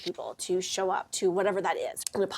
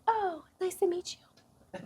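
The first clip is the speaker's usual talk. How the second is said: fake-polite greeting; 9 semitones higher, 4 dB quieter, much slower than usual. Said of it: "And she'd be like".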